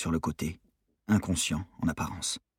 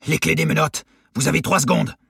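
loud, raised demand; commanding ultimatum; 9 semitones higher, 7 dB louder than usual. A narrator's reading. Armed robber gives guards an ultimatum, commanding shout. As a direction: take charge and demand it loudly.

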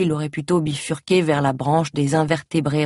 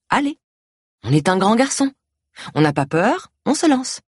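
put-on high, coaxing baby-talk to a grown man; mock encouragement; 6 semitones higher than usual. A narrator's reading. Caregiver teasing her ailing boss into obeying, mock encouraging. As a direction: coax him like a little boy. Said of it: "Je l'encourage".

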